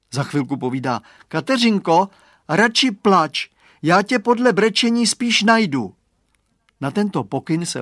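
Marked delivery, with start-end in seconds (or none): 1.3-5.9 put-on boy calling into the fog. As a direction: shout into the fog to find her.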